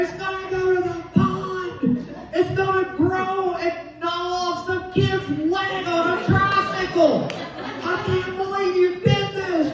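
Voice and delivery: high furious scolding; ballistic. Retold or imitated. Wife scolding husband about the alligator, high and furious. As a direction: lay into him, high and outraged. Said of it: "Well, I went ballistic".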